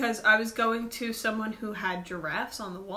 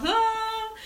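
dazed; 11 semitones higher than usual. Retold or imitated. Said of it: "I'm like".

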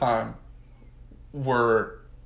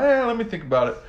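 higher, lightly voiced shrug; mock noncommittal FDR; 11 semitones higher, much faster than usual. FDR stalling Churchill with a higher, casual shrug. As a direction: brush it off casually.

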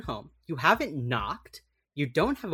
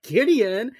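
mock shout; 8 semitones higher, 7 dB louder than usual. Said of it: "everyone's like".

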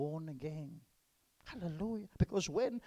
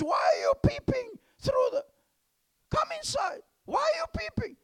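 gruff boss; 10 dB louder than usual. Acted. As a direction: call out as the startled boss. Boss voiced in funny story.

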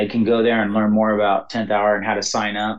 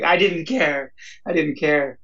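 lightly voiced, higher, earnest; Johnny's reply retold, a hint higher and earnest; moved young man; 6 semitones higher than usual. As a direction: let the words lift a little, heartfelt. Quoting Johnny's emotional reply.

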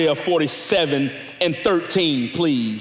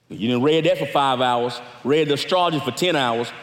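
normal talking voice

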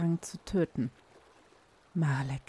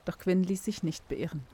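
usual speaking voice

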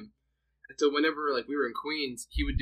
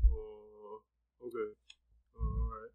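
low, quiet, sparse-worded drummer; really low voice; 4 semitones lower, 8 dB quieter, slower than usual. Acted. Impersonating his drummer friend of few words, low and quiet. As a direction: drop it low, barely bothering to speak. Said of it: "I just do a really low voice".

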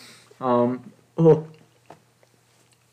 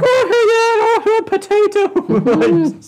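much higher, louder squeaky leprechaun; mock leprechaun; 7 dB louder than usual. Person greeting an Irishman, in much higher mock leprechaun voice. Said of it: "the person's just like".

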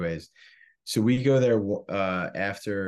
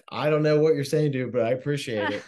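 higher and louder, baffled but grateful; confused, raised-voice gratitude; bewildered thanks; 6 semitones higher, 5 dB louder than usual. Retold or imitated. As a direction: thank the stranger, lost by his words. Drunk reply to preaching stranger.

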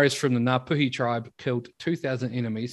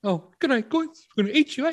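high mock cannibal voice; 14 semitones higher, 6 dB louder than usual. Voicing Maori cannibal.

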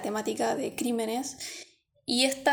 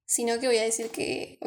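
ordinary delivery

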